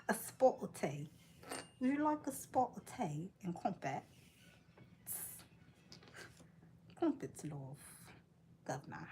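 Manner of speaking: hammed-up British accent; put-on British with broad vowels. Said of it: "I go back to British".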